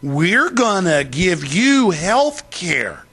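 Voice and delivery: slow, drawn-out mock promise; mocking slow campaign promise; slow politician talk. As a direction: imitate a politician talking down slowly. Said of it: "they all talk real slow".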